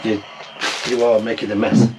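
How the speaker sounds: ordinary talk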